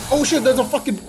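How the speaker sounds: high, loud mock panic; hammed-up terrified shout; imitated screaming. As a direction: yell it like a frightened bystander. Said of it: "They were just screaming and like".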